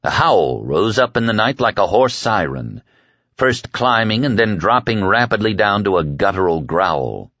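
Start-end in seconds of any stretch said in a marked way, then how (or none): none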